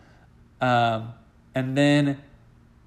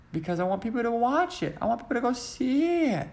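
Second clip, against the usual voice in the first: high voice climbing higher; high-pitched plea; 10 semitones higher than usual. Real, not acted. Vlogger wants viewers to see the musical, high-pitched plea. Pleads for people to see show.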